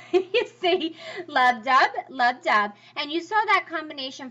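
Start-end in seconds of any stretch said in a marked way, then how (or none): 1.3-2.7 "love dub" chanted, "dub" hit harder than "love"; metrical beat. Shown as a demonstration. Teacher counting syllables in a line, rhythmic "love dub". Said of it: "ten total syllables".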